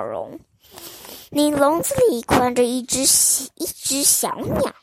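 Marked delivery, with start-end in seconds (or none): none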